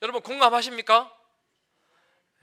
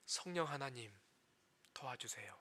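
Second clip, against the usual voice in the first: soft, low plea for help; quiet prayer; 9 semitones lower, 19 dB quieter than usual. Shown as a demonstration. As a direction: pray it quietly, like a plea under the breath. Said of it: "뭐라 해야 됩니까". (낮고 조용하게 간절히 드리는 기도)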